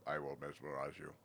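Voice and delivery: low, hammed-up fake Russian accent; mock hypnotist menace in a bad Russian accent. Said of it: "put in the bad Russian accent".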